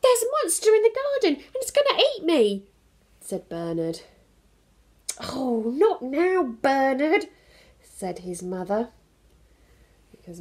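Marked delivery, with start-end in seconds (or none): 0.0-2.7 put-on much higher, louder little boy voice; chirpy young Bernard greeting; child's voice. Bernard calls out hello to his busy dad. 5.2-7.3 higher, louder, slow sighing mother; annoyed mum. Acted. Mum with the cracked vase sighs at Bernard.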